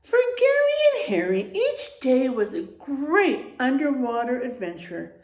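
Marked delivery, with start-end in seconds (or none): 0.0-1.5 high, fast and loud burst of excitement; excited storytelling. Acted. Teacher reading to the class, bursting with excitement. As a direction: launch the page with a thrill. Storytime read-aloud, new page.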